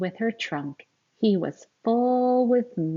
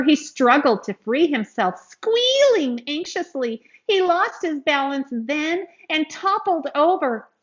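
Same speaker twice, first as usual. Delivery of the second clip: higher, louder, urgent storytelling; dramatic story narration; 7 semitones higher, 6 dB louder than usual. A narrator's reading. Reading aloud to children as calf sinks, urgent. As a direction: build the panic as the calf sinks.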